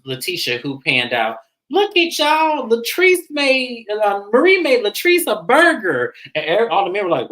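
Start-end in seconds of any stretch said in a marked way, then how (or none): 1.7-6.1 high, stretched mock announcement; excited announcement. Imitating a friend telling the arriving men, high excited announcement. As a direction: announce it to the room, pitched way up.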